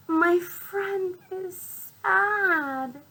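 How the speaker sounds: high, very slow piggy line; Piggy's voice. Acted. Teacher voicing Piggy for the class, high and slow. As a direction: stretch it out as little Piggy. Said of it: "Piggy says".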